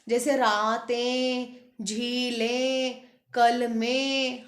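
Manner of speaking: रातें, झीलें, कलमें drawn out, each nasal ending clear; slow nasal plurals. Shown as a demonstration. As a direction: list them slowly so the nasal rings. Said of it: "हमें बिंदी सुनाई दे रही थी ना". (रातें, झीलें, कलमें धीरे अनुनासिक)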